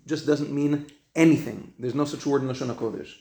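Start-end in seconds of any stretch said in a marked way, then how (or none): none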